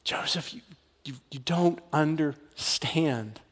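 whispered, slow, halting plea; Mary pleading with Joseph in a hush; breathless whisper. Acted. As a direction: whisper it, struggling to go on.

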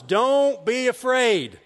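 slow, loud, high proclamation; calling out. Acted. Jesus to the frightened men in the boat, calling out slowly. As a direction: draw each word out and project it.

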